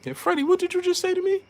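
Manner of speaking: high, fast squeaky challenge; mock Robert Garcia. Robert Garcia confronting Freddie on camera, high and fast. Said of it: "Freddie forgot the cameras was rolling".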